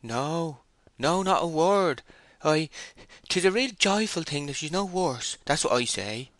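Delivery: high, halting, frightened protest; terrified. A narrator's reading. Timid man denying to the shepherd he spoke against her, terrified. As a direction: squeak out a nervous denial, faltering. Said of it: "trembling and blushing with terror".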